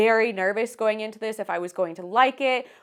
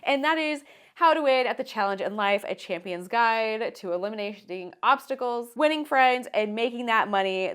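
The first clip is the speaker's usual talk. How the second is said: ordinary talk, nothing marked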